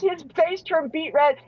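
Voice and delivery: ordinary talk, nothing marked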